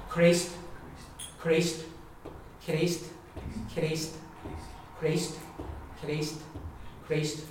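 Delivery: Christ alternating back and front K; broad and slender Christ. Shown as a demonstration. Teacher drilling both K sounds for Julie. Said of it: "if I'm saying it properly".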